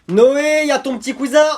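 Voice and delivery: loud, high call across the house; half-laughing shout. Acted. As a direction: yell the name to reach the next room.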